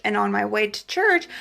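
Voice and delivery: ordinary delivery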